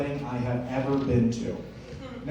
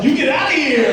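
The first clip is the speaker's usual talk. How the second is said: loud, high, laughing disbelief; laughing exclamation; 12 semitones higher, 9 dB louder than usual. Retold or imitated. Officer laughing at the comedian's joke, loud and high. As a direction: bark it out mid-laugh. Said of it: "He laughed and he was like".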